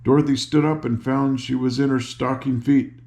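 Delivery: ordinary delivery, nothing marked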